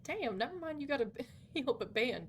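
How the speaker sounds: normal talking voice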